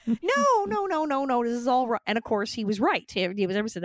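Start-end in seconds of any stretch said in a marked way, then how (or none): 0.1-2.0 mock alarmed refusal. Acting out Hollywood's alarmed refusal.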